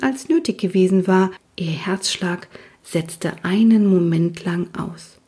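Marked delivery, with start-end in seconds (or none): none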